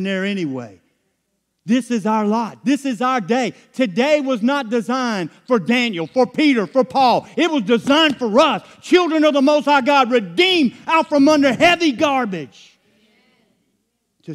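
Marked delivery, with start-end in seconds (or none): 1.6-12.8 loud, high-pitched preaching build; rally the congregation, voice raised high; full-force preaching. Acted.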